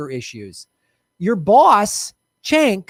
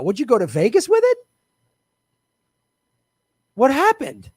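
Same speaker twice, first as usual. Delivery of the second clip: high, indignant questioning; incredulous outrage; 5 semitones higher than usual. Real, not acted. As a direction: demand answers, scandalized. Incredulous tirade at fundraising email.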